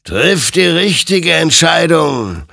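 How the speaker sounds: slow, loud, high-pitched warning; loud admonition. A narrator's reading. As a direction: admonish him forcefully, drawing the words out. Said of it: "ermahnte ihn sein Gegenüber". (eindringlich und laut ermahnend)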